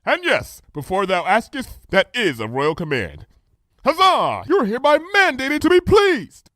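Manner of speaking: high, lofty royal voice; grand decree with a huzzah; regal princess. A narrator's reading. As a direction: play the pompous princess proclaiming. Luna's royal voice.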